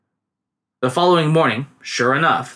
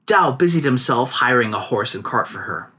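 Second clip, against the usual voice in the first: ordinary delivery, nothing marked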